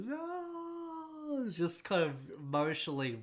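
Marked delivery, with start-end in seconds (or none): 0.0-1.5 high, strained wail of grief; imitating Chewbacca crying out; Chewie's anguished roar. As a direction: howl it like a heartbroken Wookiee. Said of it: "he just goes".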